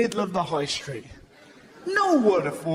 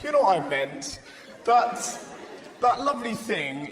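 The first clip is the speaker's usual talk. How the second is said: usual speaking voice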